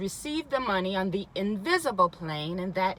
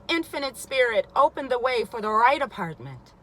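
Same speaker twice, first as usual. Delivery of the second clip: louder and higher, proclaiming each word; loud affirmation; 4 semitones higher, 7 dB louder than usual. Acted. Woman speaks the word for her apartment, loudly proclaimed. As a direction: call it out like a prayer of command.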